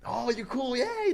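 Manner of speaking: high, mocking cheer; mock cheery. Mocking a happy crowd around the cool guy, high mock cheer. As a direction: squeal it like a carefree crowd. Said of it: "con el que no ocupa ayuda".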